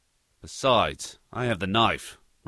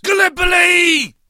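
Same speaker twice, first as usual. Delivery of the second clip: much higher, much louder shout of Gallipoli; war cry; 9 dB louder than usual. Acted. Aussie pilot roars at his captive, war cry. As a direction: bellow it like a battle cry.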